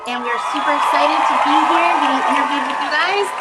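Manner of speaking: loud, thrilled greeting; pumped-up hello to the audience; excited shout. Real, not acted. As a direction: shout it out to the fans. Excited band intro, shouting.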